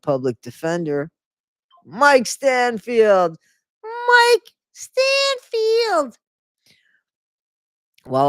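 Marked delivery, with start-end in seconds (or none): 1.8-3.4 loud, slow, raised announcement of the name; hammed-up name reveal. Reader introducing the public defender, mock-grand drawl. 3.8-6.2 very high, hugely stretched-out put-on voice; exaggerated squealed name. Reader repeating the defender's name, absurd high squeal.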